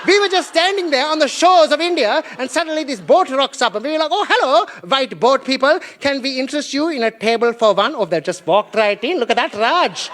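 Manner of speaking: put-on Indian accent, animated; comic Indian accent with lilting emphasis. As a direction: play an indignant Indian local in a broad Indian accent. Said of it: "The Indians are like".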